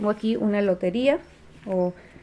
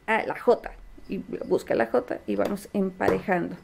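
ordinary talk, nothing marked

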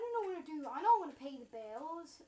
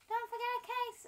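high, louder pretend granny; doting granny; 8 semitones higher, 5 dB louder, faster than usual. Granny to grandchild before the goodbye kiss, high granny voice.

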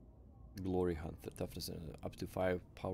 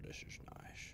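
breathy hushed whisper; 15 dB quieter than usual. Real, not acted. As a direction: say it under your breath. Repeats line as whisper.

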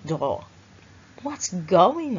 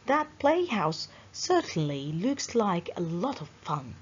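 ordinary delivery

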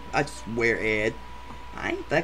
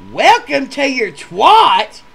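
high, loud, slow disbelieving echo; stunned repeat, stretched out loud; incredulous yell; 12 semitones higher, 13 dB louder, much slower than usual. Retold or imitated. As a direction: shout it back in total disbelief.

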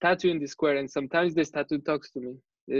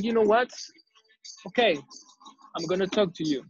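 retold irritation, starting high and firm; very pissed; 4 semitones higher than usual. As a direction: give in with mild acted annoyance. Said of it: "I'm very pissed".

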